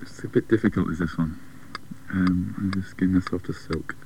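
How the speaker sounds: host quietly mulling the riddle; quiet musing. Real, not acted. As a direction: mutter the clue while thinking. Host puzzling over the riddle.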